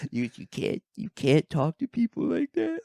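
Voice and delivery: high, wailing put-on crybaby voice; hammed-up weepy plea; mock crying drunk. Drunk man scolding the comic through tears, mock crying. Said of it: "He starts crying".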